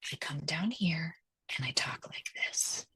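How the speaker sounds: angry low voice. Shown as a demonstration. Showing how kids hear their parent angry, low whispery voice. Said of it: "when I'm really angry".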